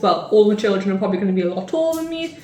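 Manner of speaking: normal talking voice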